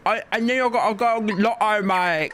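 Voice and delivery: high-pitched mock Aussie accent; hammed-up Australian twang. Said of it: "everyone talks like this".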